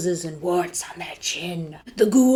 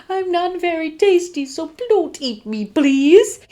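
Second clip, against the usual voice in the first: put-on high, pleading child's voice, last word louder; frightened begging; 9 semitones higher, 5 dB louder than usual. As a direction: beg for your life in a small high voice. Child begs monster on knees.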